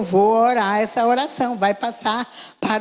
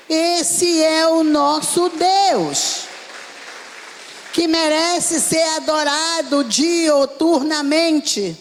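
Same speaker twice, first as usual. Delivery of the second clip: high, ringing sermon declamation; preaching at full force; 7 semitones higher than usual. Real, not acted. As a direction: proclaim it high, drawing out the key words. (pregação exaltada, em tom alto)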